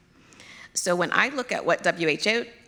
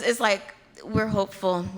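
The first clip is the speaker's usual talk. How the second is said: Nigerian accent; 4 semitones higher than usual. Real, not acted. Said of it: "You'll hear my Nigerian accent come out".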